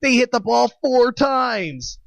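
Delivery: high, slow, dragged-out mock fan voice; mocking baseball fan. Kid in school mimicking eager baseball fans, high and slow. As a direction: stretch out sixteen hours like a bored fan. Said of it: "all the fucking baseball fans being like".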